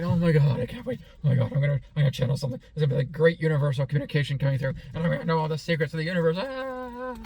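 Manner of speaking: loud, high, rushed gushing that ends in a high squeal; mock overexcitement. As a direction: parody someone bursting with hype. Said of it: "so overly excited that you're like".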